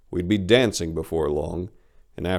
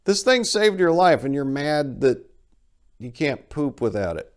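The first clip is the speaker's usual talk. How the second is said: higher, louder disbelief at a silly worry; incredulous rant; 8 semitones higher, 3 dB louder than usual. Retold or imitated. Host mocking the narrator's complaint, pitched-up disbelief. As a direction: scoff at his priorities, pitched up and loud. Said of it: "I'm like".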